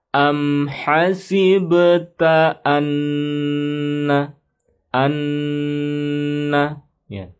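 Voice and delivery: "anna" chanted slowly with the double n held nasal; held ghunnah. Shown as a demonstration. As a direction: hold the nn of "anna" long. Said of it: "nanya ditahan tasydidnya". ("anna" dengan dengung ditahan)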